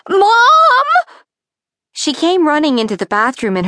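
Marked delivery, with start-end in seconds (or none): 0.1-0.9 high, loud cry for Mom; screaming. A narrator's reading. Girl finds snakes in her hair, screaming for her mom. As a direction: scream out for your mother in alarm.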